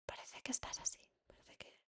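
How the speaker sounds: put-on barely audible breathy whisper to a child. Shown as a demonstration. Mother whispering to calm her upset child. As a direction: whisper so softly he has to lean in. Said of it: "muchas veces yo lo que hago es susurrar".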